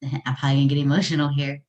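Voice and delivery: normal talking voice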